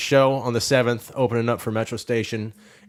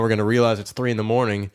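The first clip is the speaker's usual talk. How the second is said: ordinary delivery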